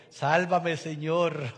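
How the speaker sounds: loud cry for rescue; desperate plea. A narrator's reading. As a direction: beg the Lord to save him. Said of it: "ahí sí dijo". ("Sálvame, Señor" gritado con desesperación)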